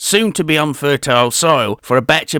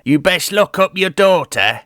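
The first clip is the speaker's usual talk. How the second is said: ordinary delivery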